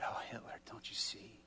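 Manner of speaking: soft whispered plea; pleading whisper. Retold or imitated. Pleading with prisoner, hushed.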